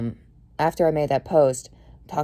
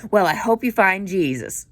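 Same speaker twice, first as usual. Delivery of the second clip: louder, higher preachy mimicry; mock pious voice; 5 semitones higher, 5 dB louder than usual. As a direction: parody a smug well-wisher. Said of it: "he's like".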